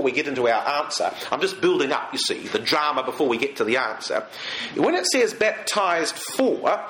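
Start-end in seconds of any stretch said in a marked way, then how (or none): 5.3-6.8 "baptized for" drawn out slowly, each word weighted; slow stress on the words. Shown as a demonstration. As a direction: lean on "baptized for", slow and deliberate. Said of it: "that word "for".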